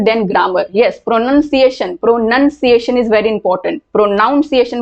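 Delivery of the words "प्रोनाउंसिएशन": प्रोनाउंसिएशन with a "noun" in the middle; common mispronunciation. Shown as a demonstration. Teacher showing what many people say, प्रोनाउंसिएशन said as "pro-NOUN-ciation". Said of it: "बहुत लोग बोलते हैं".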